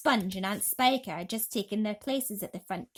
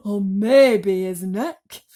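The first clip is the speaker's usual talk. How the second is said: loud, slow, gleefully cruel; hopeful Aunt Sponge; 14 dB louder, much slower than usual. A narrator's reading. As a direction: relish the thought of his neck. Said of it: "Aunt Sponge said hopefully".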